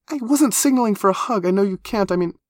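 high, excitable, embarrassed babble; awkward high-pitched character voice. Acted. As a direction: backpedal hurriedly in a high voice.

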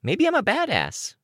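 put-on very high-pitched child character voice; Looksee. A narrator's reading. Looksee answering Tattletale back, very high-pitched. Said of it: "Looksee said".

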